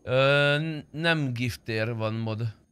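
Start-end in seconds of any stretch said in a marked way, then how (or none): none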